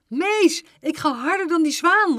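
loud, high shout; excited calling out. A narrator's reading. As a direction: call out boldly to the teacher. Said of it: "riep ze".

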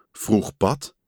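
plain reading voice

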